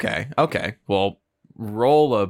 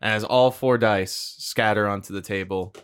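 usual speaking voice

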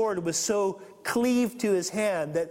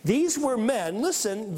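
ordinary delivery

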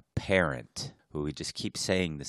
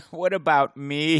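whiny buddy; 11 semitones higher, 9 dB louder than usual. Pretend. Mock whiny diver buddy.

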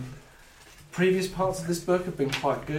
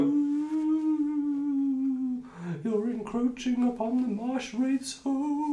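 high, wailing marsh wraith; ghostly voice; 12 semitones higher, much slower than usual. Acted. Marsh wraith warning intruders off its home, high ghostly wail. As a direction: moan it high with long hooting vowels. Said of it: "in his ghostly voice".

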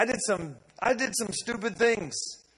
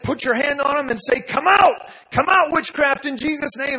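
loud, high shouted instruction; commanding shout; 7 semitones higher, 9 dB louder than usual. Retold or imitated. Preacher instructs George to cast out witchcraft, loud shout. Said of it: "I said".

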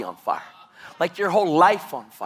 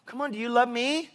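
mock needy plea; 5 semitones higher, 4 dB quieter than usual. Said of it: "do you think that God says".

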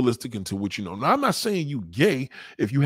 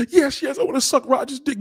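high, loud mocking woman's voice; 12 semitones higher, 4 dB louder than usual. Girlfriend blurting her wish in a high voice. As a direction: squeal it out as the girlfriend.

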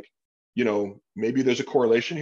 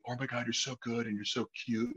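mock gushing fan; 8 dB quieter than usual. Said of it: "I don't need to be told I'm amazing".

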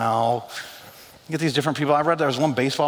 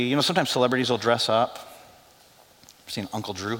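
usual speaking voice

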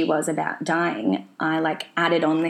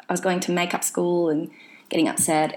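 ordinary delivery

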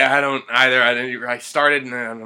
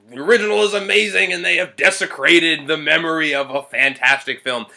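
louder, higher, rushed fan rant; mock righteous outrage; 5 semitones higher, 6 dB louder than usual. Critic voicing a hypothetical angry fan of the original, mock outrage. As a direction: rant as an indignant purist, hammed-up. Acts out an outraged fan's complaint.

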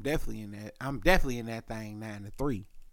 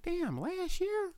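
much higher, slow disbelieving; high, stunned question; 7 dB quieter, slower than usual. Retold or imitated. Hearing about grandma, slow high disbelief. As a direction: draw it out high in shock. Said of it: "and I'm like".

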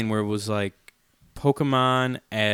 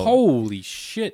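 slow, drawn out amazement; amazed; 5 semitones higher, much slower than usual. Real, not acted. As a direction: stretch it out, impressed. Reacting to forty-eight tracks.

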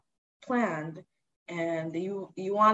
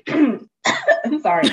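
throat catching mid-sentence; clearing a choked throat; choking on spit; 5 semitones higher, 5 dB louder, much slower than usual. Real, not acted. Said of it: "Not me choking on my own spit".

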